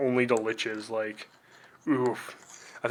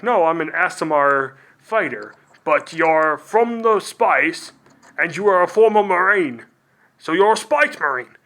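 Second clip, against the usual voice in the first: building from mildly raised to much higher and louder; indignant ASMR fighter; 4 semitones higher, 5 dB louder than usual. Acted. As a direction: correct the orc, getting more worked up with each phrase. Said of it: "And they'd be like".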